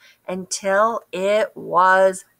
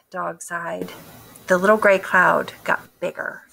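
ordinary delivery

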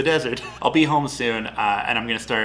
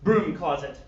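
usual speaking voice

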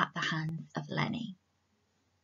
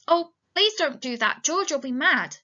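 high, loud pleading; anxious character plea; begging; 6 semitones higher, 4 dB louder than usual. A narrator's reading. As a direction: plead urgently, voice raised. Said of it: "he begged".

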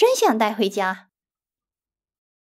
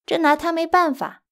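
ordinary talk, nothing marked

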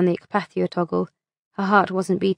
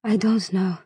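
sighing. A narrator's reading. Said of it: "sighed Isley".

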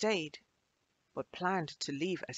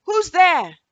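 startled call; 13 semitones higher, 16 dB louder than usual. Acted. Startled girl calls out.

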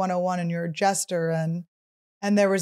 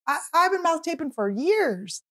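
high mock voice; 9 semitones higher than usual. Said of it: "I'm like".

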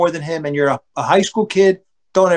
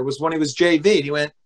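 ordinary talk

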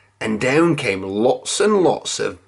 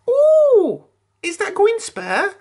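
very high, louder squeaky piggy voice; Pig eager; 3 dB louder, slower than usual. A narrator's reading. Pig eyeing the last sandwich, very high and eager. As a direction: coo it like a greedy little pig. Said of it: "he said".

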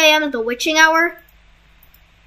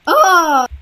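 usual speaking voice

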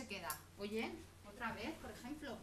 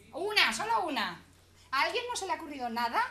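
loud urging; 3 semitones higher, 9 dB louder than usual. Real, not acted. Teacher pressing audience for ideas.

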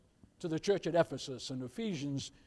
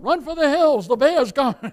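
loud, high panicked yell; hammed-up cry to flee the bear; mock panic; 7 semitones higher, 13 dB louder than usual. Preacher joking he'd flee the bear, yelling in mock panic. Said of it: "You know what I'd have been thinking about?".

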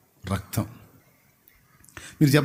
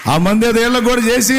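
much higher and louder, calling out; loud pleading call; 7 semitones higher, 7 dB louder than usual. Retold or imitated. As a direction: beg her eagerly across the field. Officials plead for her prayer.

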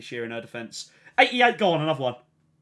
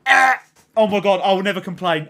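ecstatic yell; 9 dB louder than usual. Real, not acted. Streamer pulls a big player, loud ecstatic yell. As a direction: shout with joy. Great pull revealed.

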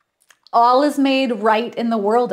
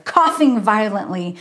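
usual speaking voice

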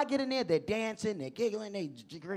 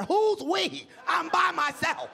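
high, indignant protest; exasperated outburst; 8 semitones higher, 3 dB louder, slower than usual. Retold or imitated. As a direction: cry out in exasperation, dragging the last words. Mad comeback to science fair line.

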